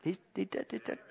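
ordinary delivery

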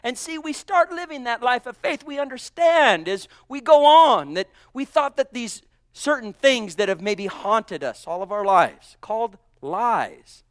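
loud, rising preacher's cry; preaching. Acted. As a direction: preach it out to the congregation. Preacher at full force.

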